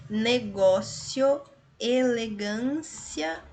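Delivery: Negócio and elegância said slowly; teacher slowly spelling words aloud; slow syllable demo. Shown as a demonstration. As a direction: sound each word out deliberately. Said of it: "Somente a B que nós temos um hiato".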